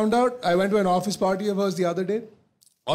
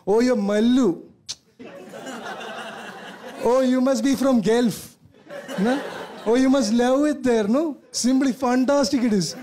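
a mocking imitation of a Mallu accent, slightly higher; 3 semitones higher, slower than usual. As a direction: parody of the Mallu accent, partly drawn out. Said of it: "everyone makes fun of our accent".

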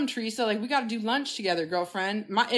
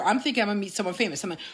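ordinary delivery

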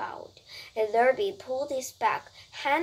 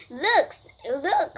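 higher, louder excited call; crying out; 5 semitones higher, 5 dB louder than usual. A narrator's reading. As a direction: cry out to draw attention. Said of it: "cried Fern".

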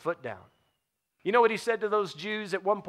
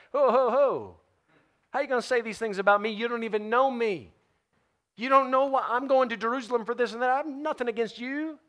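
high-pitched, hurried protest; indignant; 6 semitones higher than usual. Acted. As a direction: protest like someone wrongly accused. Acting out the wrongly accused, offended.

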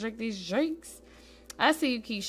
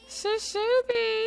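giggly squeal; 14 semitones higher, slower than usual. Real, not acted. Squealing at cute dancing.

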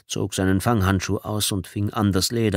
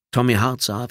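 usual speaking voice

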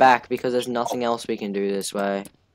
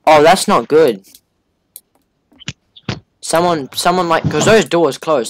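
scared horror game streamer; 4 semitones higher, 9 dB louder, slower than usual. Real, not acted. Doors close in horror game.

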